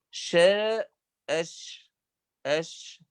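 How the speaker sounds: أش with a long hissing ش; letter demo. Shown as a demonstration. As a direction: let the air hiss on أش. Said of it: "هذا الهواء ضعف في المخرج". (نطق الشين الساكنة بجريان النفس)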